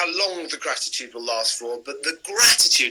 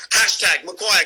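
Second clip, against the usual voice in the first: laughing; 5 semitones higher, 3 dB louder than usual. Real, not acted. Joking after bat soup gag.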